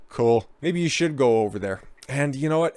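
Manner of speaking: ordinary talk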